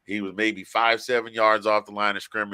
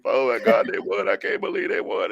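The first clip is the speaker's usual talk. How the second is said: mock sobbing; 3 dB louder than usual. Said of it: "And I'm just".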